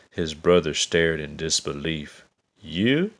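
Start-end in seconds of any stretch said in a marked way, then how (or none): 2.6-3.1 high incredulous "You?"; disbelieving. A narrator's reading. Brothers mocking little David, disbelieving. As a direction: scoff in disbelief. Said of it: "They laughed".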